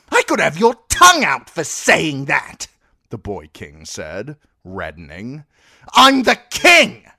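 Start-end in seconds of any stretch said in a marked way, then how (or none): 0.1-2.7 shrill, much higher and louder, demanding; a furious spoilt child-king throwing his weight; spoilt boy king. Acted. Boy king reddening with rage. 5.9-7.1 far higher and louder, a tantrum; shrieking boy king. Acted. Boy king screams his title.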